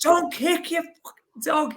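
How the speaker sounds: high, drawn-out Scouse yell; best Scouse accent. Retold or imitated. Traveller confronting a man who kicked his dog, high Scouse accent. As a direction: tell him off in your strongest Scouse. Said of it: "in me, like, the best Scouse accents".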